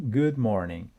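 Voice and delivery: usual speaking voice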